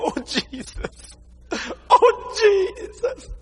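high, slow, choked-up cry to Jesus; tearful cry. Retold or imitated. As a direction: imitate the pastor weeping and pleading. Pastor crying over lost souls.

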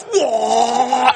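high, drawn-out whoa; awed onlookers. Retold or imitated. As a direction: imitate guys bowled over. Three guys react to her offer.

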